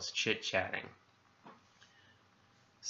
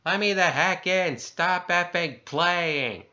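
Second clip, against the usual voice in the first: high, loud, drawn-out yell; screaming; 8 semitones higher, 6 dB louder, slower than usual. A narrator's reading. Man locked out screams to be let in, screaming. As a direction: scream it, stretching the last words. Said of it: "He's screaming".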